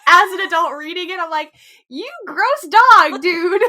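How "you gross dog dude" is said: high, loud, slow disgusted tease; slow loud playful rebuke; laughing scold. Real, not acted. Scolding lecherous old man again.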